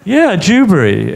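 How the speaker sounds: "Dewberry" said again, a light imitation of the lodger; lodger's Dewberry. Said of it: "you what?".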